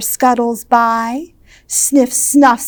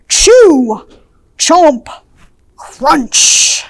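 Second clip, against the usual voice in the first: chew, chomp, crunch punched out louder; munchy and loud; 2 semitones higher, 6 dB louder, much slower than usual. A narrator's reading. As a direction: bite into each word like food. Munching sounds read out with relish.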